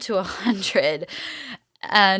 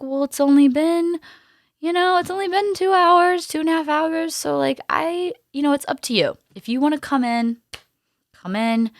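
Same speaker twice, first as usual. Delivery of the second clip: higher-pitched retold midwife voice; unhurried midwife; 10 semitones higher, 4 dB louder than usual. Midwife telling her it's only been two hours, unhurried. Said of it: "And she's still like".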